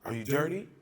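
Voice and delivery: police voice. Retold or imitated. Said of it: "police will be like".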